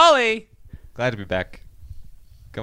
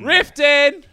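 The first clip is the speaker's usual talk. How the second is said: excited; 11 semitones higher, 5 dB louder than usual. Real, not acted. Excited guess shouted out.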